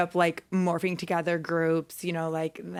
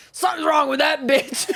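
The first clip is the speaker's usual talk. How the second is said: shouted higher mock male voice; mock guy's reaction; 8 semitones higher, 6 dB louder than usual. Friend retelling the guy's reaction, mock shout. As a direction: bark it out as the baffled guy.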